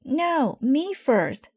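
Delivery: put-on very high child's cry; cried out. A narrator's reading. Sister squabbling with Brother over who goes first. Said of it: "cried Sister".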